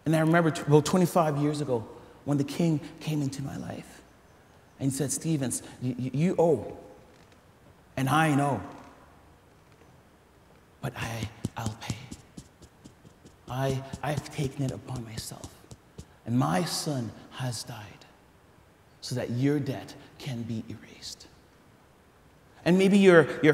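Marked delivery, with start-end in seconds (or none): 0.0-8.6 hushed retelling. Retold or imitated. Pastor voices king's quiet words. 10.8-11.9 soft whispered promise. Retold or imitated. King whispering his pledge to the servant, hushed. 13.5-15.5 hushed retelling. Retold or imitated. Pastor voices king's quiet words. 16.2-21.3 whispered. Retold or imitated. King telling servant his son died, whispered.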